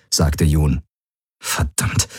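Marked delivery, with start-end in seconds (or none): 1.4-2.1 hushed, voiceless mutter; muttered curse. A narrator's reading. Galen cursing to himself as the radio is jammed, muttered. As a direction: curse softly under your breath. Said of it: "murmelte Galen".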